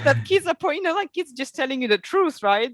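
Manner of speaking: high, laughing through words. Real, not acted. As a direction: giggle while saying it.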